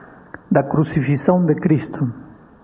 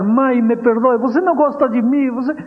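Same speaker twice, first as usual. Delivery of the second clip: put-on a kneeling boy begs his mother, voice pitched up; crying plea; 8 semitones higher than usual. A boy on his knees begs his mother, tearful high plea. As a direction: plead through tears, higher. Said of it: "se ajoelhou e começou a chorar".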